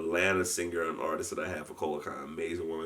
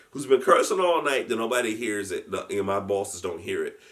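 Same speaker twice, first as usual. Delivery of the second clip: ordinary talk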